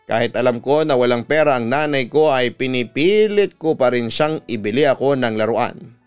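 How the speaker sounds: ordinary delivery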